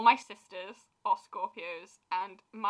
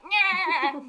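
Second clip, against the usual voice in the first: drawn-out higher-pitched nyah; playful "nyah" sound effect; snow-globe shaking noise; 7 semitones higher than usual. Retold or imitated. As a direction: imitate shaking it all up. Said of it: "just been like".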